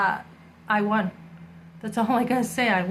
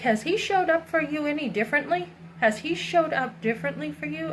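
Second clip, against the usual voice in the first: imitating a skeptical guy, higher pitched; pitched-up, pointed mock questioning; challenging question; 6 semitones higher than usual. Woman retelling a guy's questions about her ex, challenging.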